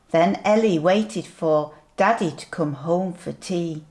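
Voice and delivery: ordinary delivery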